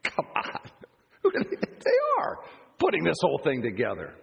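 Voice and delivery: mocking scoff with a laugh. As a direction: laugh it off as a joke.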